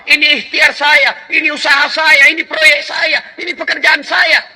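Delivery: loud, high, proud shout; arrogant boast. Acted. Korun answering those who ask about his wealth, loud arrogant boast. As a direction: boast like a rich man scorning God.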